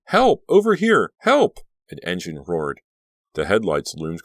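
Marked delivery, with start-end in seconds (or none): 0.0-1.6 very high, loud cries; shouting for help. A narrator's reading. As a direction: yell as loud as you can. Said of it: "shrieked out as loudly as she could".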